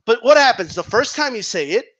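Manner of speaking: normal talking voice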